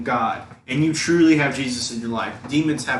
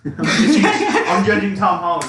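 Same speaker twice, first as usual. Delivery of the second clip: laughing; 5 semitones higher, 4 dB louder than usual. Real, not acted. Laughing tease about Tom Holland.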